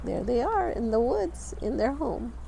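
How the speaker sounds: usual speaking voice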